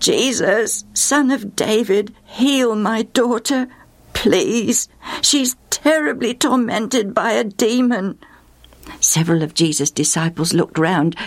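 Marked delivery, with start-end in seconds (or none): 0.0-8.2 slower, pleading repeat of the call; begging him, drawn out. Acted. Mother repeating her plea to Jesus, slower and pleading. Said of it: "began to call out".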